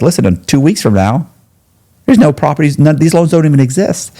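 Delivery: high, emphatic insisting; insistent. Retold or imitated. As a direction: press the point hard, voice up. Insisting to the FBI, retold.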